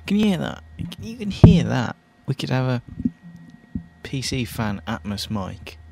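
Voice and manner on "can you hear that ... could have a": much higher and louder, startled; surprised loud outburst. Real, not acted. DJ alarmed at the fan noise on air, loud and high. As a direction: blurt it out, shocked at the noise.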